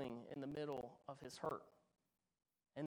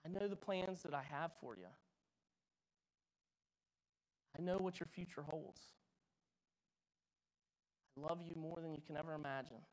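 calm reassuring God; 5 dB quieter, faster than usual. Pretend. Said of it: "God calmed our hearts and said".

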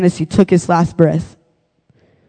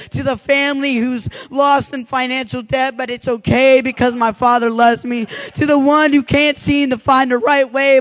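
higher preacher's litany with a refrain; altar call; 5 semitones higher than usual. Acted.

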